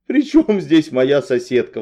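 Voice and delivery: outraged at the mix-up; indignant, drawn-out question. A narrator's reading. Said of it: "возмутилась я".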